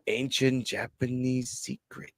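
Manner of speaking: slow, softly delivered secret; hushed mock reveal. Actor reveals his fake tears to Briggs, hushed and slow. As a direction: let the secret out slowly and quietly. Acts out the faker's sly reveal.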